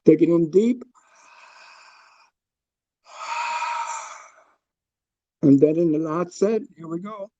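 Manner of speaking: calm, paced breathing instructions; guided breathwork. Acted. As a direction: guide the group through each breath. Leads a breathing exercise.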